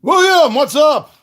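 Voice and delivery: high, loud happy hello; excited greeting. Real, not acted. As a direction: call out the name gladly. Greeting a viewer by name.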